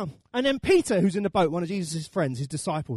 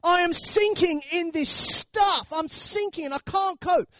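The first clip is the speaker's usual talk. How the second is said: high, drawn-out cry to God; acted anguished wail, slowly easing off; desperate prayer; 8 semitones higher, slower than usual. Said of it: "has anyone ever prayed that prayer".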